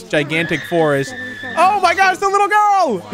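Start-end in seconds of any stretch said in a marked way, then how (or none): 1.6-3.0 much higher, loud disbelief; high shocked yelp; shocked cry. Real, not acted. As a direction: cry out as she's taken. Girl gets eaten.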